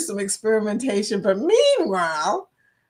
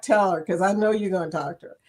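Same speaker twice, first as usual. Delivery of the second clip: normal talking voice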